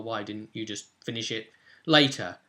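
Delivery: ordinary talk, nothing marked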